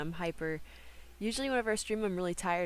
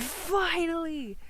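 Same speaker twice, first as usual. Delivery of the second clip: dragged-out cheer; 9 semitones higher, 4 dB louder, much slower than usual. Retold or imitated. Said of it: "Knowles said".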